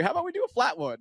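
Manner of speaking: high, light coaxing suggestion. Retold or imitated. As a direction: pitch it up to win him over. Coaxing runner.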